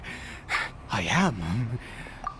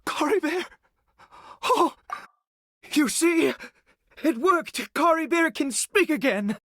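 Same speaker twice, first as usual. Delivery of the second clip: overjoyed; 10 semitones higher than usual. Acted. Father rejoicing that his son speaks again, overjoyed. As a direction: burst out with delight at the miracle.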